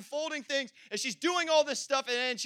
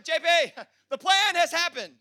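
high, loud mock announcement of success; hammed-up thrilled wife; wife announcing the plan worked, mock excited; imitating excited wife; 7 semitones higher, 6 dB louder than usual. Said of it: "She's like".

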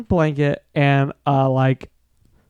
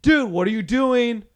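retold exasperation climbing ever higher in pitch; mock alarmed protest; 7 semitones higher than usual. Retelling friends confronting a tent intruder.

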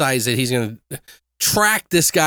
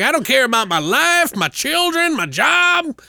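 much higher, gushing trucker parody; mock devoted trucker; 11 semitones higher than usual. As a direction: swoon over the salesman in a high silly voice.

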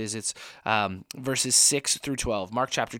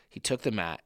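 ordinary talk, nothing marked

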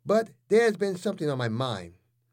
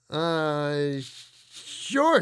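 nervous. A narrator's reading. Girlfriend dodging the question, nervous. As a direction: stall nervously before answering. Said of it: "said Becky nervously".

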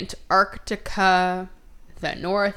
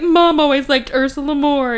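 high, whiny jealous-sibling imitation; hammed-up petulant complaint; mock whining; 6 semitones higher, 4 dB louder than usual. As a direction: whine like the less-loved child. Mimics jealous sister's complaint.

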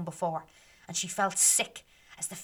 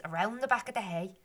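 ordinary delivery

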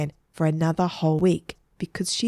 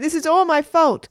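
high, loud distressed outburst; shouted confession; 10 semitones higher, 6 dB louder than usual. A narrator's reading. Margaret to waitresses threatening Egbert, distressed shout. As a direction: shout the blame onto yourself. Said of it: "shouted Margaret".

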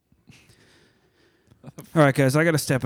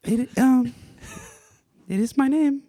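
much higher put-on character voice; shy masked oddball woman; 12 semitones higher, 3 dB louder than usual. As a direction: introduce yourself as an awkward, shy guest. Plays Stephanie, a shy guest.

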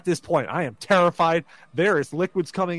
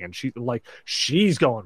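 usual speaking voice

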